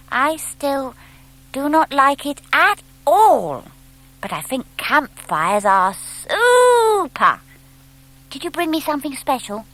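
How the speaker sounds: little owl begging his mother to stay out; eager little owl. A narrator's reading. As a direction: coax Mummy with wide-eyed hope. Plop speaking to mother.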